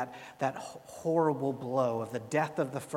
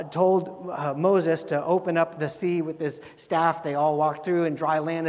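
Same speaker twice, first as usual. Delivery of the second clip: ordinary talk, nothing marked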